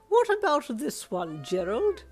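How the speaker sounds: high, loud, slow snobby lady; pompous voice. Acted. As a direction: look down your nose as you ask. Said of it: "the lady asked in a pompous voice".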